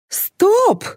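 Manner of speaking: very high sudden cry; yelling. A narrator's reading. Director cuts the take on set, yelling. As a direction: halt everyone with one high yell. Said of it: "заорал Гриша".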